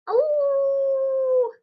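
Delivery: very high, loud drawn-out howl; long loud howling oh. Acted. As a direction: let Vinny howl with delight. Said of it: "Vinny howled".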